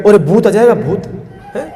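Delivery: mock-scary parent hushing a crying child; imitating a parent shushing a child; parent scaring a child. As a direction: hush the baby with a ghost threat, lightly voiced. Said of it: "तो हम क्या कहते हैं".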